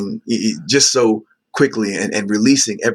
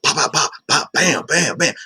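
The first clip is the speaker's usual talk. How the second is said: punchy, higher-pitched pop-pop-bam volley; imitated rapid-fire gunshots; 6 semitones higher than usual. Recalling his outpouring to a counselor, imitated gunfire bursts. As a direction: mimic words firing off like shots. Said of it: "that sounded like what I, like you".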